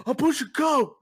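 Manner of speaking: loud high deadpan yell; same loud yell. Retold or imitated. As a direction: shout it flat-faced, no smile. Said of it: "He just".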